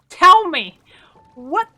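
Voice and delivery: exasperated demand. Real, not acted. Demanding answers from the glare.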